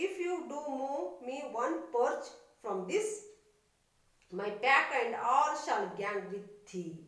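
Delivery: loud, formal recital of the ballad; poem reading. Acted. Teacher reading ballad to children, in a loud recital voice. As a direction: declaim the verse to the class.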